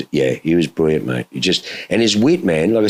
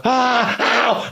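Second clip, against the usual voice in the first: high, sudden ow of a sharp burn; startled high ouch; retold yelp of pain. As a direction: yelp as the heat hits your leg. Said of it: "And I was like".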